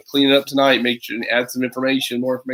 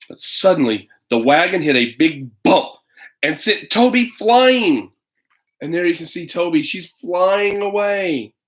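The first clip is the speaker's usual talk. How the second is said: storybook narration that climbs higher as she flies; lively story reading; 5 semitones higher, slower than usual. Acted. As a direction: build the wonder as she sails up. Dramatic picture book reading.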